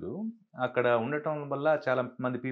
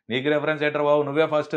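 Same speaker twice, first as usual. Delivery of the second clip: louder, higher mock-incredulous retort; Gogineni retold; 4 semitones higher, 7 dB louder, faster than usual. Official answers an applicant asking for a reference, retold as loud retort. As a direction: lightly imitate an official brushing off the question. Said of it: "అక్కడ గోగినేని గారు".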